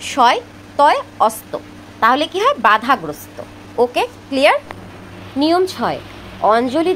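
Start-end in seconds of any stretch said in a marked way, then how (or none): 0.1-1.5 শয় তয় অস্ত spelt out with a pause after শয় and অস্ত pressed harder; emphatic correct spelling. Shown as a demonstration. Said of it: "কিন্তু না, সঠিক বানানটা হবে".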